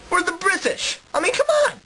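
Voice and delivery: high-pitched incredulous boast; boastful Briton. Acted. British interviewee brags of taking over, high and incredulous. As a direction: scoff as if victory were obvious.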